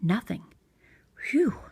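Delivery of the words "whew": whispered high sigh of relief; relieved whew. A narrator's reading. Bear finding no mouse, whispered whew. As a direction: breathe out the relief.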